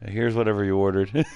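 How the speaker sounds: flat, uninterested coffee server; bored barista. Pretend. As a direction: hand over the cup like you don't care. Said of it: "what about your Dave Barista impression?".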